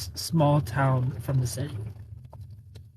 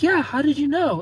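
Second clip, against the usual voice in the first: put-on city girl, much higher; 11 semitones higher, 4 dB louder than usual. City girl voiced in story.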